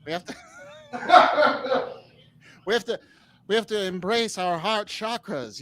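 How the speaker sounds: mid-Atlantic accent, mock spiritual sermon; Marianne Williamson impression. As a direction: lay on the old-movie mid-Atlantic accent, preaching the inner self. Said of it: "I might do an impression".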